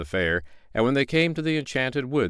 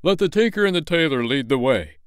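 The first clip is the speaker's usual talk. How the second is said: a bit higher and louder, official; mayor's order; 6 semitones higher, 4 dB louder than usual. Acted. Mayor sends the tinker and tailor first, pompous. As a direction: push others ahead. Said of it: "said the mayor".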